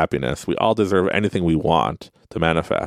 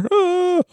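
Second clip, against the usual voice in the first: sharp high cry of frustration; a quick squeal; mock exasperated cry. Exclamation after mock inner voice.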